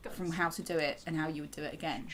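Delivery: ordinary delivery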